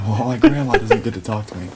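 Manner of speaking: loud, indignant retelling of their complaint; mock outrage. Producers complaining about a turtle phone call, in loud mock outrage. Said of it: "they were infuriated".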